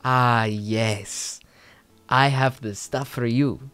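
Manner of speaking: imitated Spanish-accented English. Spanish girl offers the stuff to customers, Spanish accent. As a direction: put on her Spanish accent, measured and deliberate. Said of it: "very nice English Spanish accent".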